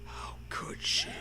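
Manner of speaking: hushed, breathy disbelief; shocked little whisper. Real, not acted. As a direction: whisper it like a scandal. Shocked aside at Zoe's menu.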